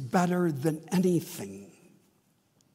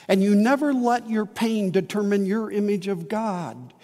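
ordinary talk, nothing marked

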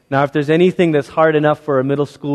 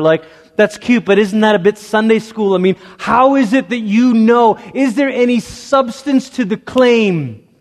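higher, louder, mocking doubt; skeptic challenge; 7 semitones higher, 3 dB louder than usual. Preacher voicing a skeptic who challenges the church. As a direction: press him like a doubting skeptic.

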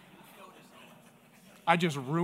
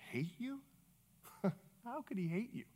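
softly incredulous, pitched up; hushed disbelief; 2 semitones higher, 13 dB quieter than usual. Retold or imitated. Pastor answering a hurting man, hushed disbelief.

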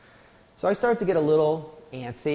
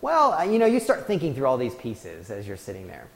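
ordinary talk, nothing marked

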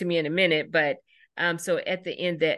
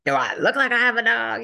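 higher, louder snarky retort; sassy boy; 7 semitones higher, 6 dB louder than usual. Retold or imitated. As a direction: sneer the question back. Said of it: "He was pretty ass like".

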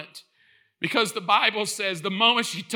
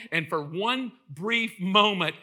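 normal talking voice

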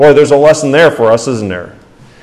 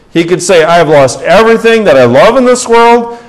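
raised, loud pulpit questions to the congregation; fervent preaching; 7 semitones higher, 6 dB louder than usual. Acted. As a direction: preach it out, voice lifted high and strong. Preaching at full force.

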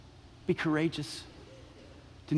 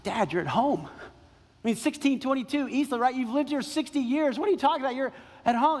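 higher, pleading disbelief; a daughter begging her dad to make sense; raised, incredulous daughter's plea; exasperated daughter; 6 semitones higher than usual. Retold or imitated. Daughter pleading with confused father.

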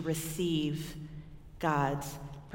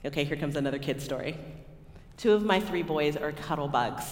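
normal talking voice

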